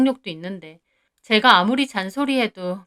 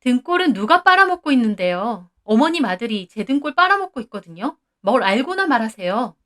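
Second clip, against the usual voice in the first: shouting back; 2 semitones higher, 3 dB louder than usual. Acted. Wife shouting back at her mother-in-law. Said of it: "소리를 질렀습니다".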